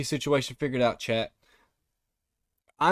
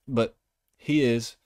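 normal talking voice

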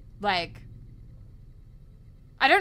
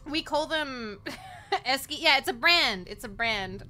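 ordinary talk

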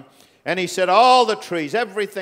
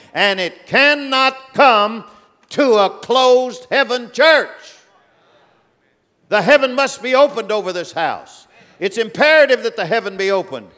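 preaching at full force; 5 semitones higher, 5 dB louder than usual. Real, not acted. Man of God warning the church, loud and high. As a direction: thunder the warning over the house.

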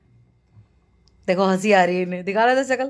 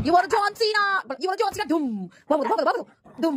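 hyped commentary; 13 semitones higher than usual. Pretend. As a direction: play announcer for the dogs. Wrestling commentary on dog play. (कुत्तों की कुश्ती पर ऊँची जोशीली commentary)

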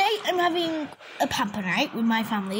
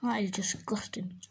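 much softer, unvoiced disgusted verdict; disgusted whisper; 4 semitones lower, 10 dB quieter than usual. Real, not acted. To viewers after tasting Fanta, a disgusted whisper. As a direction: breathe it out in revulsion.

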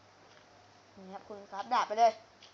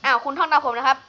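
mock teacher; 10 dB louder than usual. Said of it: "มาสอนเด็กหน่อยเร็ว".

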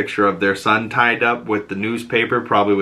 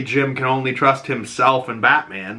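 ordinary talk, nothing marked

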